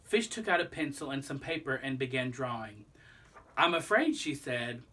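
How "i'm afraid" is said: upbeat admission. Acted. Fish cheerfully plans a fix.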